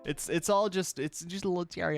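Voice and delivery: usual speaking voice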